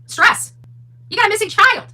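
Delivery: building to a high, loud disbelieving shout on "Stress"; rising incredulous outrage, up to a near shriek; outraged. Real, not acted. Outraged rant about stress quote.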